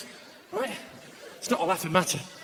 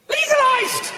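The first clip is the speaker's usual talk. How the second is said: shouted way higher; sudden high yell; slower than usual. Shown as a demonstration. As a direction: blurt the word legalised in a high yelp. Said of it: "I said legalised".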